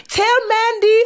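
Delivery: mock Kenyan accent done loosely. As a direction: imitate a Kenyan woman's accent badly, light touch. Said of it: "I'm terrible with accents".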